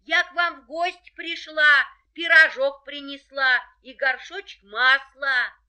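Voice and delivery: wolf in a high, thin voice; wolf faking a little girl's voice; wolf in disguise. A narrator's reading. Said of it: "ответил волк тоненьким голоском".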